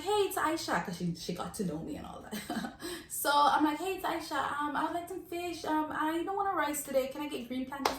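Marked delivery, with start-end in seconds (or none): 0.0-0.8 high, chirpy caller hello; pitched-up acted greeting; friendly phone greeting. Regular calling up the restaurant lady, friendly phone greeting. 3.8-8.0 high, sing-song customer voice; acted sweet request, pitched well up; polite phone ordering. Customer ordering fish and green plantains, polite phone ordering.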